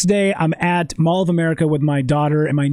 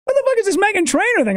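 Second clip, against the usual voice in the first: baffled sister; 13 semitones higher, faster than usual. Retold or imitated. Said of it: "my sister's like".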